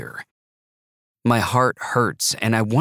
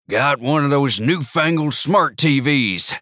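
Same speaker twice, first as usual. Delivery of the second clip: put-on proud old grandpa, a bit higher; 5 semitones higher, 3 dB louder than usual. Narrator voices Grandpa.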